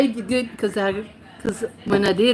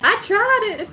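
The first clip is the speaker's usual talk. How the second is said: laughing through the words; real laugh while saying it; 12 semitones higher, 3 dB louder than usual. Real, not acted. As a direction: giggle out the confession. Laughing admission on stream.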